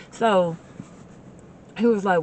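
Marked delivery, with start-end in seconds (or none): none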